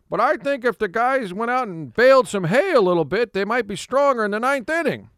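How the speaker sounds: high mock Tony voice, earnest; imitating Tony. Tony answers a caller's question, high mock earnest. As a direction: answer a silly question with full credence. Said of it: "And he would say".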